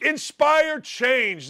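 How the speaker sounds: high, very drawn-out mock earnestness; mocking slogan. Host parrots the league's campaign, slow and mocking. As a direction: stretch it out to ridicule the slogan.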